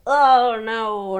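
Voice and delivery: alarmed oh no; sudden dismay; dismayed gasp. Real, not acted. Reacting to card.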